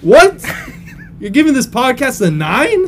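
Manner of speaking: high, loud incredulous squeal with a laugh; shocked laughing disbelief; stunned disbelief. Acted. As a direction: react as if you can't believe it. Host stunned by guest's rating.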